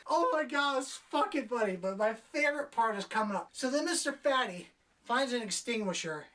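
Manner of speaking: ordinary talk, nothing marked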